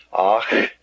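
"Ach" with a rough German throat sound. Shown as a demonstration. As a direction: rasp "Ach" at the back of the throat. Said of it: "like the German in Ach".

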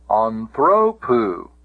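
anthropu drawn out slowly, stress on "thro"; stress on penult. Shown as a demonstration. Teacher showing the genitive case, anthropu stressed on "thro". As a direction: lean on the middle syllable "thro" in anthropu. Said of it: "notice where the accent is".

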